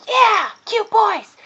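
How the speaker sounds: high, loud, drawn-out cheer; slow, squealy mock fangirl yell; excited cheer. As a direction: whoop it like a fan. Acting out her own fangirl cheer.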